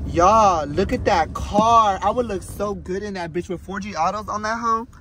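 ordinary talk

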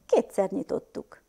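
ordinary delivery

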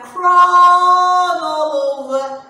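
"crawled" stretched long, loud and high; slow, swelling sing-song on "crawled all over"; drawn-out storytelling. Acted. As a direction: belt out CRAWLED like a held note. Recalling ants crawling in story.

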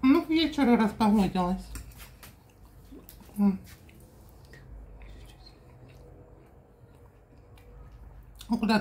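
talking while chewing; eating between words; mouth full. Real, not acted. Seen 2: Eats from the pan just before; chewing visible during the words.

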